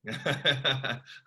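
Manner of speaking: appreciative burst of laughter; short run of open chuckles; real laugh. Real, not acted. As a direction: laugh along at the guest's pep talk. Host amused by guest's pep talk.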